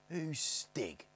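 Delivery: high, drawn-out wary question; suspicious. A narrator's reading. Eldest questions the smiling boy, suspicious. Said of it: "said the eldest Snarget suspiciously".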